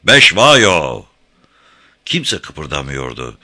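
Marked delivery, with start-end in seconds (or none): 0.0-1.0 powerful, drawn-out shout; Beşvayo. A narrator's reading. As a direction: shout the name out over the hills. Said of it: "öyle bir güçle bağırdı ki". (tüm gücüyle bağırarak)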